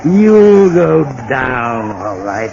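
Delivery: slow gloating menace. Acted. Villain gloats over the fall.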